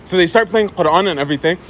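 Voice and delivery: usual speaking voice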